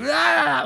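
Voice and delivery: high mock yell in someone's ear; sudden high comic holler. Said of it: "like, yelling in Ezekiel's ear".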